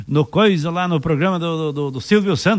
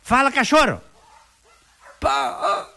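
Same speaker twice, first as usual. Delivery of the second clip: commanding the dog; 9 semitones higher, slower than usual. Acted. Owner hitting the dog.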